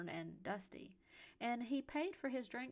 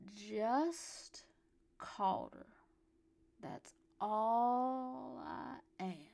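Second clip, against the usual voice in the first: put-on loud, halting, long-drawn drunken words; slurred drunk; 2 semitones higher, 5 dB louder, much slower than usual. A narrator's reading. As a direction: pause and stretch it out tipsily. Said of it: "he slurred".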